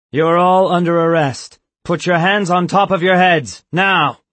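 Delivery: loud barked arrest order; commanding shout. Acted. Guard ordering the board at gunpoint, loud shout. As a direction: bark the arrest at them.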